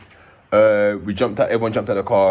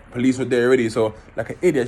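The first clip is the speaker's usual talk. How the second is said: ordinary delivery, nothing marked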